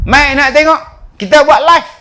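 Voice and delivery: high and loud, demanding; angry mother. Acted. Angry mother shouting at the school for her child. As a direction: storm in demanding the teacher.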